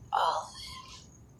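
breathy whispered repeat. Acted. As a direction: drop it to a bare whisper. Repeated phrase, whispered take.

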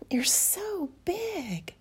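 slow, soft overwhelmed whisper; breathy whisper. Acted. Overwhelmed, gasping.

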